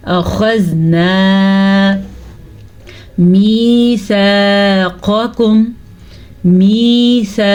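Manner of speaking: slow, drawn-out tajweed recitation; Quranic recitation. Shown as a demonstration. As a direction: recite slowly and melodically. Recites verse slowly. (धीमी तिलावत)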